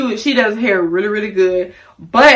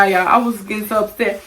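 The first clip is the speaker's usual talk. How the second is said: ordinary talk, nothing marked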